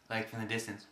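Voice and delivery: ordinary talk, nothing marked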